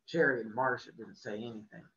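ordinary delivery, nothing marked

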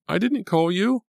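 very high, loud protest; alarmed denial. A narrator's reading. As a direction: insist on innocence, alarmed. Alarmed girl denying she called him.

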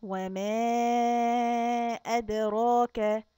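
وما with the alif stretched long before the hamza; long madd. Shown as a demonstration. Teacher showing kids how to stretch وما, long madd. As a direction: draw out the vowel in وما a long time. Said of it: "هنمد كتير ونقول وما".

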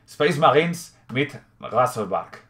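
slow, careful German accent with a throaty German R in "Razorback"; German accent, "Razorback" said "Gasorbark" with a throaty R. Shown as a demonstration. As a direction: read the box label like a German, stretching "Space Marines mit Razorback". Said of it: "puedo intentar imitar el acento alemán".

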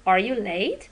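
"Are you late" climbs up on "late"; rising tune. Shown as a demonstration. Teacher models a rising yes/no question. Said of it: "از پایین به بالا".

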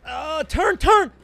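rising high yell on turn; loud high panic; urgent shout. Real, not acted. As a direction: bark the order at the car. Steering away from pursuit.

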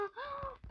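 sudden high gasp of alarm; sharp gasp. Real, not acted. Gamer losing track of green, sharp gasp. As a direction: gasp as the colour vanishes. Can't find green, panics.

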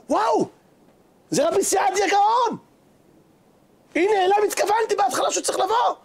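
high-pitched, amazed cry of recognition; astonished shouting. Retold or imitated. Town rabbi recognizes the great sage, astonished shout. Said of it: "אשר שמע את הצעקות".

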